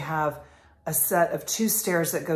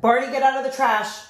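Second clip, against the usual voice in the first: loud, higher call at the dog; yelling at dog; 9 semitones higher, 8 dB louder than usual. Real, not acted. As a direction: snap the dog off the trash. Scolding dog mid-video.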